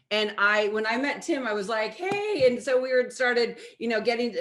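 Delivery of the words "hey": much higher, playful greeting; flirty hey. Retold or imitated. Meeting Tim for the first time, playful flirty hello. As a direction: toss it out like a flirt.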